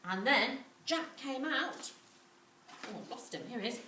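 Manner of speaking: ordinary delivery, nothing marked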